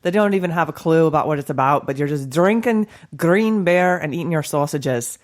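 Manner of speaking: lightly put-on Scottish accent, playful toast; a hint of a Scottish brogue, teasing the Americans. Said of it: "in my best Scottish voice".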